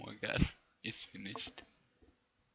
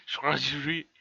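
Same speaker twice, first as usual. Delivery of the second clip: high, loud mock scream of a word; hammed-up shriek, played for laughs; gibberish scream; 4 semitones higher, 8 dB louder than usual. Hitler jumping up, screaming at being caught, loud mock shriek. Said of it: "screamed in gibberish".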